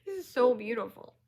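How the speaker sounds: high, emotional awe; close to tears. Real, not acted. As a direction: say it overcome by the beauty. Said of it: "I'm gonna cry".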